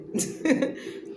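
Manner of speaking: a quick breathy giggle at herself; light laugh. Real, not acted. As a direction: laugh it off, amused. Laughing at own hair.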